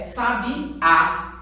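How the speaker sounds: "sabiá" devagar, terminando forte no Á; tônica na última sílaba. Shown as a demonstration. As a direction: separe "sabiá" com acento no Á final. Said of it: "Ela está acentuada na última sílaba". (palavra "sabiá", oxítona, tônica em Á)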